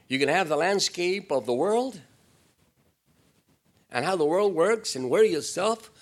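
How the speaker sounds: loud, high preaching push; fiery preaching. Acted. Preacher rousing the church, loud and high. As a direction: drive it home to the congregation.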